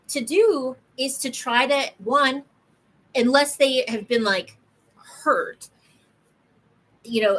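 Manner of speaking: usual speaking voice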